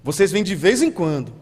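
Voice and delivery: ordinary talk, nothing marked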